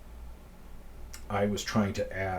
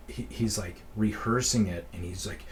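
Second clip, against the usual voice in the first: normal talking voice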